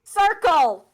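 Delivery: shouting the word loud and high; shouted. Acted. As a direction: yell it out. Shape shouting game.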